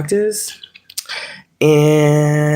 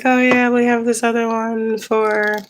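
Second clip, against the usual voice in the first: imitating the lady; 8 semitones higher, slower than usual. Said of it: "she's like".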